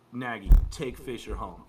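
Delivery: loud call to a coworker across a noisy bar; sudden loud shout-out to a friend. Retold or imitated. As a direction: call out the order so he hears you over the room.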